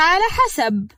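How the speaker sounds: a high, put-on sniffle before the words; fake sob. Mai hedging to her father-in-law with a fake sniffle.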